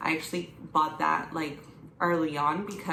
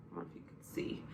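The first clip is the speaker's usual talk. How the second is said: whisper; 13 semitones lower, 13 dB quieter, much faster than usual. Real, not acted. Aside to viewers.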